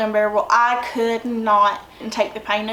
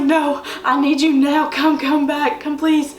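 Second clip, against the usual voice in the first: pleading; 3 semitones higher than usual. Retold or imitated. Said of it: "I was like".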